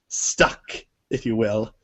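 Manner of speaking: usual speaking voice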